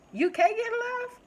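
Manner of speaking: high, loud disbelief; astonished, high-pitched outburst; shocked. Retold or imitated. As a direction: squeal it, voice shooting way up in amazement. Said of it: "I'm just shocked that".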